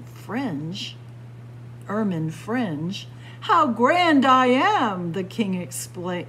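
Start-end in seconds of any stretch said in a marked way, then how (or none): 3.4-5.1 loud, slow, grand boast; self-important royal exclamation; exclaiming. A narrator's reading. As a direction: exclaim it high and drawn out.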